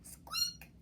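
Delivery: very high squeaky mouse cry; high mouse squeak. Acted. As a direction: squeak in alarm. Mouse squeak sound effect.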